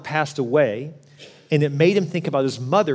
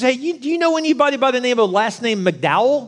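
higher, lightly voiced old lady; a knowing old woman's guess; 9 semitones higher than usual. Old lady asks about family.

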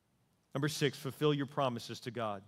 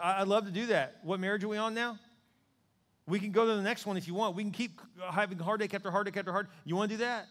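higher, louder voice of God; God's challenge; 5 semitones higher, 4 dB louder than usual. Acted. Preacher voicing God confronting the believer, pressing. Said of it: "God's like".

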